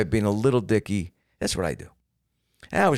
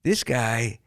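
drawn out and a touch higher; calm admirer voice; 5 semitones higher, slower than usual. Retold or imitated. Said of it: "everyone's like".

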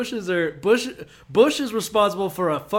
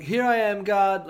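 eager reply; 5 semitones higher, 4 dB louder, slower than usual. Retold or imitated. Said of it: "And they're like".

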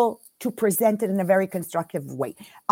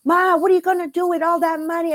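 cousin's voice; 7 semitones higher, faster than usual. Retold or imitated. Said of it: "my cousin in Italy says".

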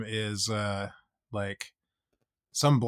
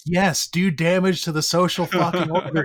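loud, higher-pitched exasperated plea; exasperated outburst; 6 semitones higher, 5 dB louder than usual. Acted. Listener reacting to the bankers' bailout, loudly exasperated. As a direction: raise your voice in emphatic mock appeal.

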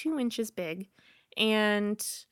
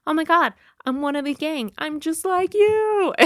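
louder, rising joy, last words stretched high; thrilled realization; 8 semitones higher, 8 dB louder than usual. Retold or imitated. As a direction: burst out with happy relief. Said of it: "So then I thought".

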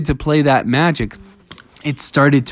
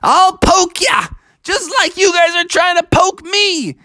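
very high, excitable character threat; high-pitched playful bravado; threatening Bubby. Acted. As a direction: threaten them in a squeaky, hammed-up voice. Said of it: "He said".

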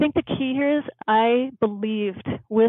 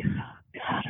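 breathy whisper; 9 dB quieter than usual. Retold or imitated. Reacting to father's death note.

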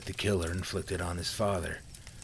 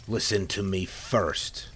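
usual speaking voice

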